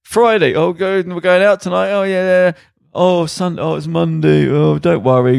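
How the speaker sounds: mock radio patter. Mocking radio weekday patter.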